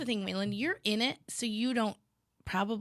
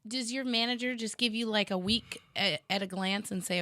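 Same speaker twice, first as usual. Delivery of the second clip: ordinary talk, nothing marked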